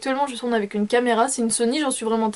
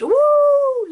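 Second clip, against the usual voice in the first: excited whoop; 6 dB louder than usual. Real, not acted. Excited about buying a programme.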